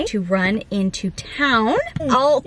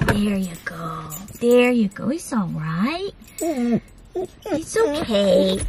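calming the puppy; 4 semitones lower, much slower than usual. Real, not acted. Owner soothing the puppy on the vet table, slow and tender. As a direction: settle him down tenderly.

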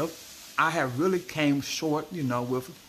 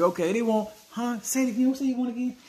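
mock confused parent; 10 semitones higher, faster than usual. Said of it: "and he say".

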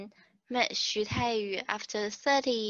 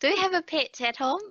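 Kiwi pet; 7 semitones higher, faster than usual. Retold or imitated. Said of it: "And I was like, "Pet?".

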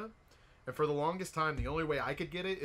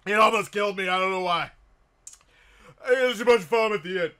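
hoarse after coughing; 6 semitones higher, 13 dB louder than usual. Real, not acted. Just coughing after chug.

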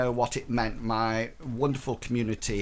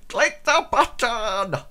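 silly voice; 7 semitones higher, 3 dB louder, slower than usual. Pretend. Slow put-on voice urging.

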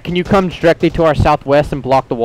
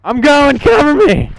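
very high, loud battlefield yell; cover me shout; 13 semitones higher, 4 dB louder than usual. Real, not acted. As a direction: scream it while sprinting for cover. Running under fire, calling out.

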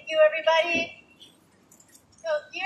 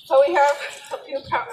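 breathless, catching breath; winded after walking, gasping slightly. Real, not acted. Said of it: "sorry, I'm catching my breath".

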